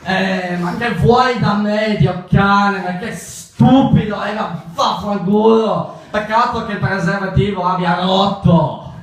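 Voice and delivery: high, loud hammed-up Italian shouting; angry Italian reply. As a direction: yell back in mock-furious Italian. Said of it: "the response for that might be".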